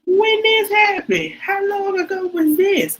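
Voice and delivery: high, loud, slow shocked questioning. Retold or imitated. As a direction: demand details in disbelief. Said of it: "And so I said".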